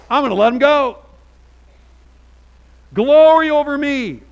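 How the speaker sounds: high, loud, gleeful shouting; excited whoop. Retold or imitated. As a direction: cheer like you just got freed. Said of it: "I literally think Moses thought".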